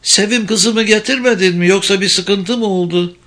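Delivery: high-pitched anxious mother calling; mother's high, concerned question; curious, slightly worried. Acted. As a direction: ask with curiosity and a touch of worry. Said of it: "merakla ve biraz da endişeyle seslendi".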